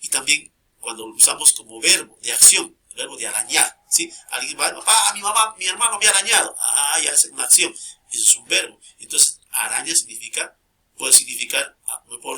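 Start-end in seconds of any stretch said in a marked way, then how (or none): 4.7-6.5 put-on higher child's tattling voice; complaining child. Child tells papá that a brother scratched them, complaining. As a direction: play a kid telling on a sibling.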